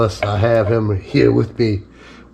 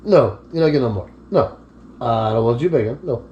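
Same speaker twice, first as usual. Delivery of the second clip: ordinary talk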